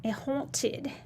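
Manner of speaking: "haunted" softly drawn out as "haw-tid"; playfully eerie, stretched "haw-tid" for "haunted"; odd put-on haunted. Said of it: "Why am I talking like that?".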